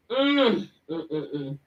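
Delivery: ordinary delivery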